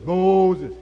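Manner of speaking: loud, drawn-out divine call; booming God voice. Retold or imitated. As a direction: call him from the bush like God. Voice of God from bush.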